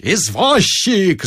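long drawn-out yelled hail. A narrator's reading. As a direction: call the cab across the street. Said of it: "завопил Остап".

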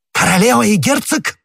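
high, loud cry of disbelief; astonished outburst at the news; startled exclamation. A narrator's reading. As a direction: exclaim it sharply as a question, pitch leaping up. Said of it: "воскликнул Ришелье".

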